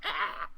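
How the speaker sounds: high, squeaky rat-like shriek; mock jump scare scream. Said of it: "It's like".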